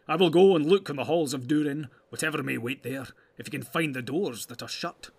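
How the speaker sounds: lightly voiced dwarf, loud opening; a hint of a bold dwarf; resolute dwarf. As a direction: pledge it stoutly.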